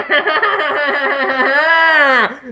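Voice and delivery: long, high villain cackle; Skeletor cackle. Acted. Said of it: "Skeletor's like".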